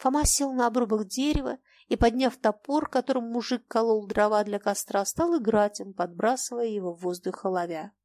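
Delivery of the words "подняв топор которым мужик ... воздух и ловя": plain reading voice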